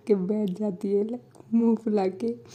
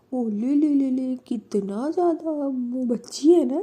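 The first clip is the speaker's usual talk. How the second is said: put-on cooing baby talk; 4 semitones higher, 6 dB louder than usual. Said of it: "तो वंदना बोलती है".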